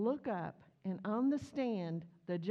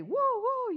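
much higher and louder mock siren whoop; siren imitation; 14 semitones higher, 8 dB louder than usual. Police car arriving, imitated siren whoops.

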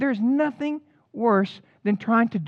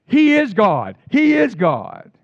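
loud, slowing declaration; proclaiming; 6 dB louder, slower than usual. Retold or imitated. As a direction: cry out in awe, drawn out. People proclaiming on the ground.

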